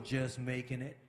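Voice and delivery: low, deflated mock answer; imitating a glum grumbler; downbeat reply. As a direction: drop it low like someone barely coping. Imitates people speaking bad.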